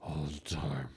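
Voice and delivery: low, quiet Batman voice. Shown as a demonstration. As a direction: slip into Batman voice. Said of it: "talk like this".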